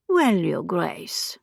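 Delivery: put-on slow, husky French accent; unimpressed madame drawing out the words. A narrator's reading. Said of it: "The accent remained".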